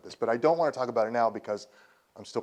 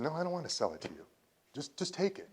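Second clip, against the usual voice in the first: real emotion, softly retelling a friend's words; soft and halting, moved by the memory; emotional; 5 semitones higher, 7 dB quieter than usual. Real, not acted. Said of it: "I'm sorry I get emotional".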